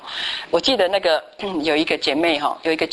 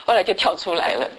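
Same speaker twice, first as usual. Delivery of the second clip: normal talking voice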